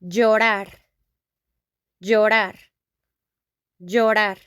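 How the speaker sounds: llorar with y-like ll and tapped r; teacher modelling llorar; Spanish double L. Shown as a demonstration. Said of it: "the meaning in Spanish, it's".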